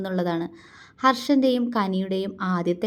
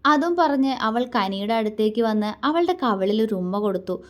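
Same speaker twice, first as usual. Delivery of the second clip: usual speaking voice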